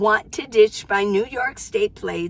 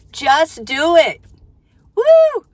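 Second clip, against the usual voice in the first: wild cheer; 7 semitones higher, 9 dB louder, much slower than usual. Real, not acted.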